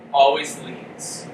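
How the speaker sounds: ordinary delivery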